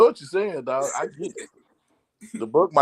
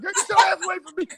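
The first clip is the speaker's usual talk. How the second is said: laughing mock yell; 14 semitones higher, much faster than usual. Retold yelling at grandbaby.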